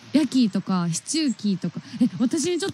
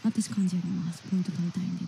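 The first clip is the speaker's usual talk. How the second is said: softly voiced, lower aside as a quote; quiet imagined volunteer; 4 semitones lower, 8 dB quieter than usual. Volunteering as organiser to collect points, quietly imitated. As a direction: imitate someone volunteering, low and soft. Voicing a would-be party organiser.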